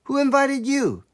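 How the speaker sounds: high indignant shout. Acted. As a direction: shoo them off with a high cry. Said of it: "shouted the little tailor".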